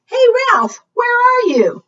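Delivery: calling out loud. Acted. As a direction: shout up for the dog, high and loud. Owner calling out for dog.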